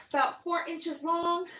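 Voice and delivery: ordinary delivery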